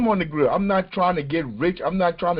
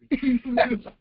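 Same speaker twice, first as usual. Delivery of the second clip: laughing; 7 semitones higher than usual. Real, not acted. Laughing at the video.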